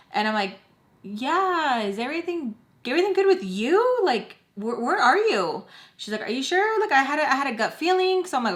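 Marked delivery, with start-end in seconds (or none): 1.0-5.6 puzzled questioning. Retold or imitated. Acting out puzzled reply to mom. 6.2-8.3 upbeat mom. Retold or imitated. Voicing happy mother on phone.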